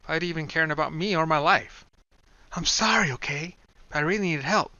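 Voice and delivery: put-on a pleading young woman. Kai, higher female voice.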